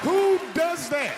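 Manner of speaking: high, slow, loud disbelief; outraged shout. Acted. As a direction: yell it drawn out, appalled. Outraged at wife opening box.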